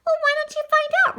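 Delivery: quick, high-pitched comeback; sharp retort. A narrator's reading. New boy answering the cocky kid, high quick retort. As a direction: fire back at a challenge, high and fast. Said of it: "Riley retorted".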